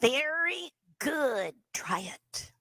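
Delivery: slow nasal "very good" as "thery good". Shown as a demonstration. Teacher drilling the nasal dummy voice. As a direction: drawl it through the nose, lips still. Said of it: "Put that little nasal sound in there".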